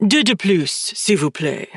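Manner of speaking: put-on purred French accent, overly emphasized; overdone French accent. Raph ordering from a cute waiter in a thick purred French accent. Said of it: "purrs in an overly emphasized French accent".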